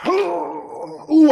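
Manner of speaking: sharp high gasp into ooh; shocked gasp. Real, not acted. Big roll result, gasp.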